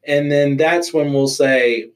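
ordinary delivery

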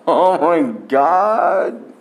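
slow, high, appalled groan; disgusted. Real, not acted. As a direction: drag it out in disgust. Disgusted at the dirty bathroom.

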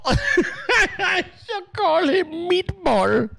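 slow, high, laughing through the line; laughing imitation. Host repeating the clip line to viewers, laughing hard. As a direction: repeat it while cracking up. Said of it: "Was that Trevor saying meatball?".

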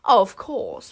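loud, creaky little key voice; creaky little boast. A narrator's reading. Key boasting to Wish, creaky and self-important. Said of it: "in a creaky little voice".

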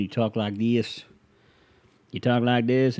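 put-on Southern US twang; Southern accent. Shown as a demonstration. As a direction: imitate a Southerner's accent mockingly. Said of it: "unless you're from the South".

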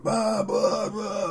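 garbled yelling heard through a window; mock shout with blurred words; muffled distant shouting. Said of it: "like, shouting outside, but, like, dull".

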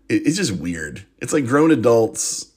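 normal talking voice

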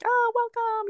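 high, lilting mock welcome; welcoming greeters. Airport greeters welcoming arriving tourists, high excited imitation. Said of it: "They're like".

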